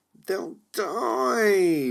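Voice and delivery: high, loud, tearful whine; a crying whine, drawn out on "die"; whining. Acted. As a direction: wail it like a child near tears. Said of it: "whined Angela for the third time".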